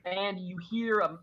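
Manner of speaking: ordinary talk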